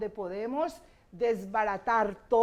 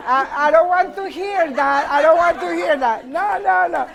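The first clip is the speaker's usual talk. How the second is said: loud, higher, amused objection; laughing protest; 5 semitones higher, 10 dB louder than usual. Real, not acted. Teacher reacts to cheeky class comments, laughing protest. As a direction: refuse to hear it, loudly, through laughter.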